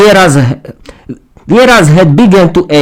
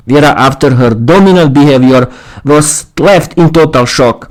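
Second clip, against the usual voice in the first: ordinary delivery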